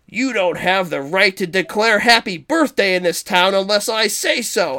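high, emphatic overruling; mock indignant retort. Sewer boss overruling the health inspector, high mock indignation. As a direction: put them in their place, hammed-up.

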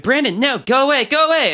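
high, pleading shoo-away; mock shrill plea to a brother; shooing little brother. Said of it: "And she's like".

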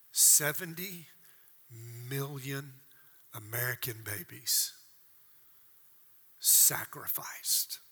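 slow, breathy whisper of a single word. Real, not acted. Preacher to congregation, hushed and slow. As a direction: let the word drop to a hush. Grim point about abortion.

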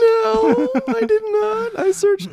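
squeaky high indignant no; defensive denial. Acted.

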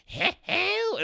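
very high, thin hello; his ghost voice. Retold or imitated. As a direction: squeak it out high and strange. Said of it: "I am doing how he".

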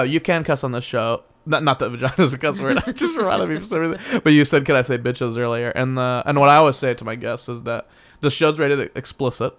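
ordinary talk

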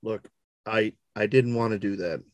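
very low, soft, halting admission; a little shaken. Acted. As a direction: admit it quietly, faltering at the start. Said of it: "B is a little bit visibly shaken".

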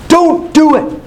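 pitched high, pleading don't do it; imitated urgent plea. Sick preacher begs a young believer not to pray, high urgent plea. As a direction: beg him to stop, voice up high.

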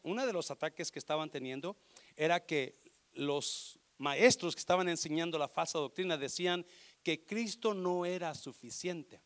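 ordinary talk, nothing marked

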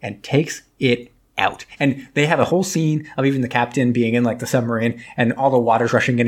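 ordinary delivery

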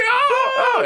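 very high hammed-up sob; mock crying. Peanut grieves his baby, very high mock sobbing. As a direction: wail like an overacted weeper. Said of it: "He's just, like, crying".

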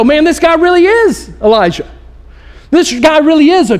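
lightly voiced amazed onlooker; awed excitement. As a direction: gush about the prophet in wonder. Crowd marvelling at Jesus.

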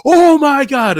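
loud, high-pitched mock scream; hammed-up, drawn-out astonished cry; starstruck shock. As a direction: imitate a mother losing it over a celebrity. Shocked mom sees celebrity.

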